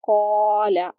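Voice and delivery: कौला with a heavy "qau" and the alif held one count; heavy qaf drawn. Shown as a demonstration. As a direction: fill the mouth on कौला and stretch the alif. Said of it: "एक अलिफ के बराबर खींचेंगे और पुर पढ़ेंगे".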